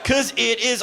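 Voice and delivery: high, strained mock delight; panicked fake laughter. Comic learning he overdosed on edibles, panicked cheer.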